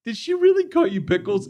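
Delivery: high-pitched, barely composed mock apology; imitating tearful suppressed laughter. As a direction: act like you're fighting back tears of laughter. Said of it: "I'm sitting here crying".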